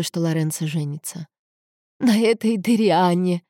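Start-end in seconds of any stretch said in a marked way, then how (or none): 2.0-3.4 slow, mildly sobbing voice; understated weepy indignation; tearful sob. A narrator's reading. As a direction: drag the words out through tears. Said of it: "всхлипнула она".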